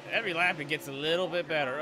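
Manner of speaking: normal talking voice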